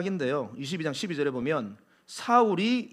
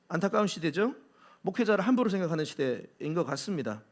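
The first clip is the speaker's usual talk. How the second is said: normal talking voice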